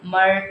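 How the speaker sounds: "मर" stretched on a rolled r; noon turned to ra. Shown as a demonstration. Teacher letting students hear the difference, long r on "मर". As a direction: end "मर" on a long r. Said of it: "फ़र्क भी आवाज़ में".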